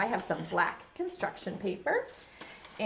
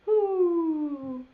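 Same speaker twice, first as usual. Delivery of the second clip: Bear's voice; 7 semitones higher, 4 dB louder than usual. Shown as a demonstration. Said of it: "Can you say it?".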